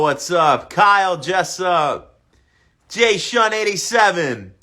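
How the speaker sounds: usual speaking voice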